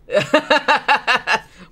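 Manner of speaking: high, loud burst of real laughter; big laugh. Real, not acted. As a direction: crack up at the joke. Cracking up at co-host's joke.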